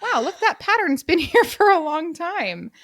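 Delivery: high, laughing wow of discovery; a bemused, amused aha; mock realization. Recalling spotting an old pattern, high and laughing. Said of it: "I'm like".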